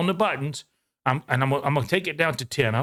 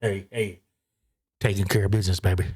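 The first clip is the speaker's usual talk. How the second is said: low, crooning [name] voice; [name] impression; 7 semitones lower than usual. Showing how Memphis talks, a low [name] croon. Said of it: "Memphis more like [name]".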